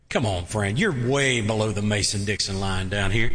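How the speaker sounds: normal talking voice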